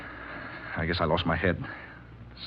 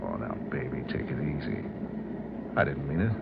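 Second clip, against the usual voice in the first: softer, lower coaxing; calm her down gently; backing off tenderly to calm her; soothing; 7 semitones lower, 8 dB quieter than usual. Acted. Soothing his upset partner.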